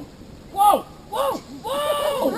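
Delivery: mock panic, coming unglued on the sled; panicked whoa. Shown as a demonstration. Guide imitating a panicking guest stopping the team, hammed-up panic. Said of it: "you're gonna go".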